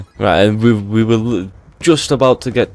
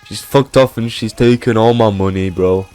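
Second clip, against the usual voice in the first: a mild put-on moan, pitched up at first; imitated aggrieved complaint; 3 semitones higher than usual.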